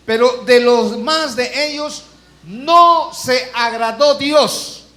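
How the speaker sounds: "no se agradó Dios" slowed right down and pushed out loud; emphatic scripture reading. Acted. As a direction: read the verse as a solemn warning, drawn out.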